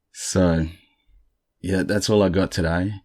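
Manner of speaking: usual speaking voice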